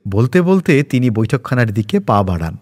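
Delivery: ordinary delivery, nothing marked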